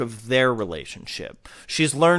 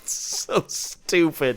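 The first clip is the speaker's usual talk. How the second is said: slow disbelief; 3 semitones higher, much slower than usual. Real, not acted. Drawn-out disbelief.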